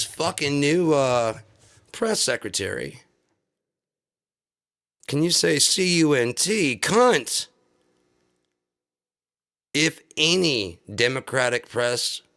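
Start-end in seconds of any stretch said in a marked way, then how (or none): 5.6-7.5 letters slowly spelled, then the word yelled high; slow spelling into a loud payoff; spelled out then shouted. Real, not acted.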